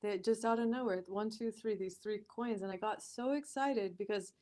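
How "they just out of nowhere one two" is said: ordinary delivery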